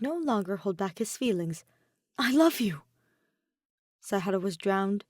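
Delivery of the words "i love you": blurted, a little louder; earnest sudden declaration; heartfelt confession. Acted. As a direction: let it burst out before you can stop it.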